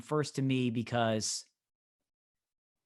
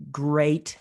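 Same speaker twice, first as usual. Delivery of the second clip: "great," set apart with pauses around it and said with extra weight; stress on great; 4 dB louder than usual. Real, not acted. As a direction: lean hard on "great," after a pause. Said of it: "I emphasize the word great".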